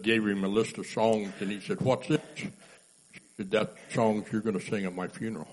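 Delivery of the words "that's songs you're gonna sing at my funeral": ordinary talk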